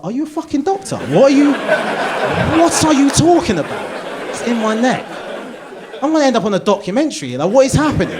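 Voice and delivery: loud, high-pitched comic disbelief; incredulous outburst. Retold or imitated. Patient told his leg vein is in his neck, incredulous outburst. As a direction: challenge him in mounting, hammed-up alarm.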